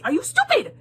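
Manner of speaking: high, loud mocking disbelief, near crying; incredulous outburst. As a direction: shriek it in outraged disbelief. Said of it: "Phoenix is literally looking at him like".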